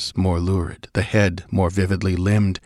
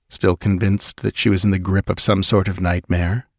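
ordinary delivery, nothing marked

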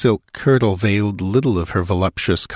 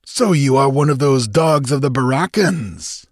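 higher, scornful questioning; unpleasant scorn; 6 semitones higher than usual. A narrator's reading. Captain looks down on the outlaw, scornfully. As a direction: sneer the question at him. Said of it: "the thin lip lifted unpleasantly".

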